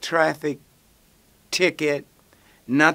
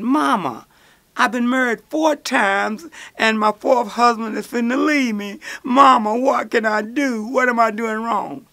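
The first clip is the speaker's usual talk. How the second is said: higher pleading daughter; whiny daughter; 7 semitones higher than usual. Acted. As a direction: beg mama for help.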